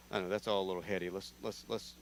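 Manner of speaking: usual speaking voice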